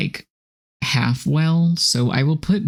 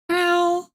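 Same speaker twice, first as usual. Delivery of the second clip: squeaky yelp. Real, not acted. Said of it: "Someone clip that".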